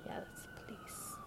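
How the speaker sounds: hushed whisper; whispered aside about the police. Real, not acted. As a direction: breathe it out unseen. Noticing police outside.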